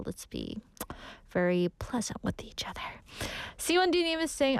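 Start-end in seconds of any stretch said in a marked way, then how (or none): 0.1-3.0 soft whispered advice; whispering. Real, not acted. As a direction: whisper it kindly. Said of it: "soft closing advice".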